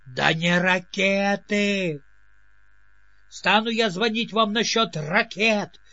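ordinary delivery, nothing marked

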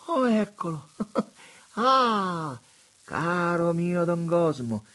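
high, loud, gushing bishop's voice; high delighted exclamations of a fawning prelate. A narrator's reading. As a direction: coo over the view like a delighted old monsignor. Monsignore greets Don Cosmo.

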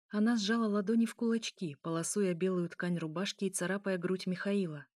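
ordinary delivery, nothing marked

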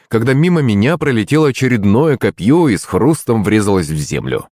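usual speaking voice

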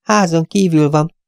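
high, triumphant receptionist. Acted. Receptionist triumphantly telling Poirot the boss is out, high. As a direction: announce it as a small victory. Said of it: "jelentette diadalmasan".

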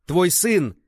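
high, loud imploring cry; desperate pleading. A narrator's reading. Accused man begging father to take son, desperate plea. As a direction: beg with full voice. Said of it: "взмолился Ван Сяоши".

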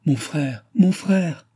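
high, loud cry, rising on the repeat; sister's excited high-pitched call; exclaiming. A narrator's reading. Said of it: "Exclama André".

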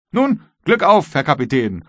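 high, loud farewell; hearty send-off. A narrator's reading. Guard officer bids the captain farewell, high and loud. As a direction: call out the good wishes high and loud.

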